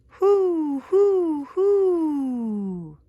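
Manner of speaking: long falling owl calls; owl hoot. Pretend. Imitating the hidden owl's call.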